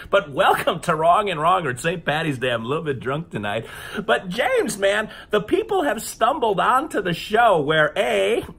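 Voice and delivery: ordinary talk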